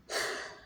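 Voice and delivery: a long sigh of release; soft exhale of relief; relieved sigh. Shown as a demonstration. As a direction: let the breath go as if finally free. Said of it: "This is the best I can do to show you".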